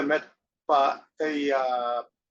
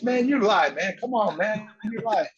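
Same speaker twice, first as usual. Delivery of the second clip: mock disbelief; 7 semitones higher, 5 dB louder than usual. Friends disbelieving on phone.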